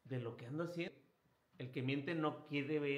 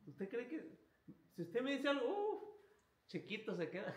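playful tease; 8 semitones higher than usual. Real, not acted. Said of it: "preacher teasing the congregation".

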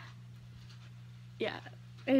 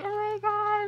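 high, slightly louder exclamation; surprised gasp; 10 semitones higher, 3 dB louder than usual. Retold or imitated. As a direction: catch your breath in surprise. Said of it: "was like".

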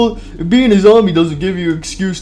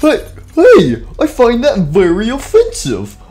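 outraged; 6 semitones higher, 4 dB louder than usual. Acted. Intruder in pizzeria, outraged.

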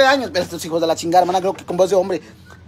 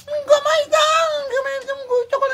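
mock tiny voice. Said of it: "sale con su pendejada de voz". (vocecita aguda de burla, alargada)

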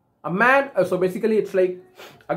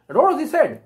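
ordinary talk, nothing marked

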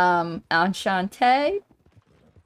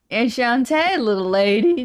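louder, drawling country greeting with French words; French cowboy; 3 semitones higher, 3 dB louder than usual. Pretend. Gamer greets a lady as a French cowboy. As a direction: tip the hat like a French cowboy. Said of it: "French cowboys".

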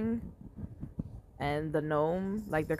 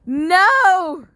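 much higher, very loud wail of no; anguished shout; 13 semitones higher, 15 dB louder than usual. Real, not acted. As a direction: despair as it's lost. Unit dies.

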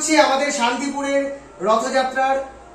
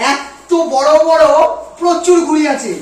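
excited; 3 semitones higher, 6 dB louder, slower than usual. Real, not acted. Shows off huge kites.

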